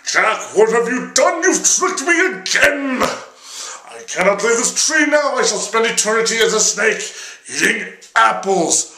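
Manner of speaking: high, loud put-on devil voice; squeaky outraged devil. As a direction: play the trapped devil, whining and indignant. Devil voiced in told story.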